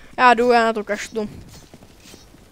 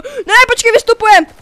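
1 urgent call; 8 semitones higher, 8 dB louder than usual. Real, not acted.

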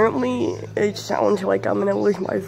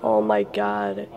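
hoarse voice; 11 semitones lower than usual. Real, not acted. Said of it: "I sound so weird on camera".

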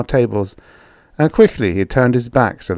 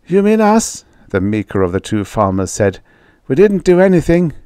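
frightened meek farmer; 6 semitones higher than usual. Acted.

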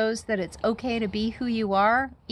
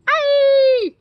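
piercing, far higher and much louder shriek; 12 dB louder than usual. A narrator's reading. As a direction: let out a terrified shriek. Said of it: "shrieked Flap".

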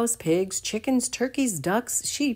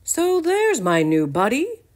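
higher, louder hearty greeting; friendly Farmer Dan; 9 semitones higher, 6 dB louder than usual. Acted. Farmer Dan greeting Buttercup, hearty and friendly. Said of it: "Farmer Dan said".

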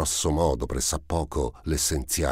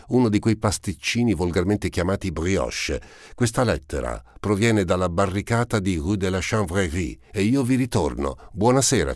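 higher, louder urchin voice full of bravado; cocky street boy; 5 semitones higher, 3 dB louder than usual. Acted. Gavroche bidding Valjean goodnight before the barricade, cocky higher urchin voice. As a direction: toss it off like a proud kid heading back to the fight.

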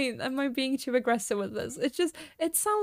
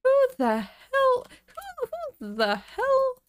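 mock outrage; 11 semitones higher, slower than usual. Game bed destroyed, mock outrage.

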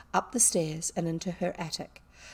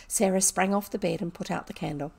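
usual speaking voice

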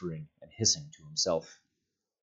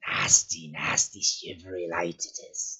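hissing Gollum. A narrator's reading. Gollum hissing at the light he hates. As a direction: hiss it like a wretched cave creature. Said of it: "he hissed".

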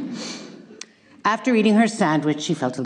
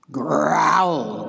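papa bear growl. Acted. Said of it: "growled the papa bear".

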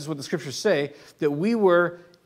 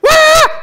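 loud high-pitched mock wail; sudden piercing "wah" like a screaming kid; imitated child scream; 13 dB louder than usual. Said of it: "a kid who just starts screaming".

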